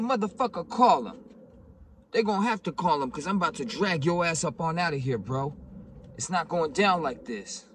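loud, high-pitched retold threat; acted angry shouting. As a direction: shout back through the door, daring him.

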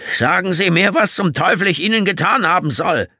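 Jeremy yells at the killer on the phone, shrill and strained; shrill, strained shout. Acted. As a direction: shriek it at the caller. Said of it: "Seine Stimme war schrill, zum Zerreißen gespannt".

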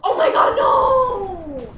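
loud, high crying-out no; anguished shout. Real, not acted. Shouting as she falls in the game, loud and high with a cry in it. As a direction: wail at the loss. Losing the round.

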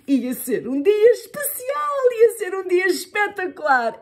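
excited. A narrator's reading. Excited narration of the special day.

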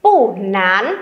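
"Pu nán": falling pu, rising nán; measured model of "Pu nán"; fourth then second tone. Shown as a demonstration. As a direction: drop on "Pu", then lift "nán". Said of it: "Nán này là thanh hai".

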